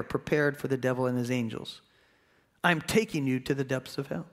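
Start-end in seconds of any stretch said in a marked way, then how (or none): none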